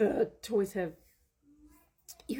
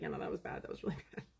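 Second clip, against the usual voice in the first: husband quoted much softer, breaking into a laugh; lower, hushed husband laughing; quiet husband; 4 semitones lower, 9 dB quieter, faster than usual. Retold or imitated. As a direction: murmur it low with a suppressed laugh. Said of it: "he was like".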